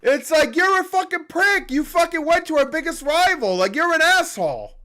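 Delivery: very high-pitched, exasperated rant; angry retort. Acted. Fan answering absent player's complaint, angrily exasperated. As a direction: throw the insults at him in outrage.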